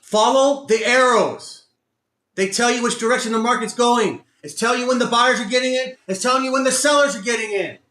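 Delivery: high, loud, emphatic rant; real exasperated shouting; angry self-scolding. Real, not acted. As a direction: yell the rules at yourself in frustration. Angrily lecturing himself on rules.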